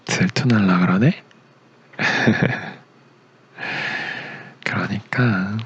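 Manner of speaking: ordinary talk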